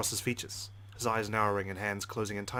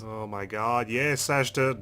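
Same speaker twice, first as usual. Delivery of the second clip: loud, fed-up yes; exasperated; 7 dB louder, much slower than usual. Acted. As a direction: snap it, out of patience. Exasperated answer.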